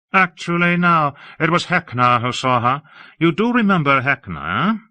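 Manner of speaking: a lofty, admiring high voice; Hawke, airy and effusive. Acted. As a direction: gush about the city grandly.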